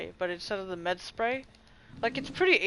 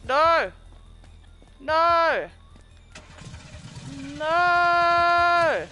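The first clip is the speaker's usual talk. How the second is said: high, loud repeated cry; despairing shout; 10 semitones higher, 8 dB louder, much slower than usual. Real, not acted. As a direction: wail it as all is lost. Despair as car leaves.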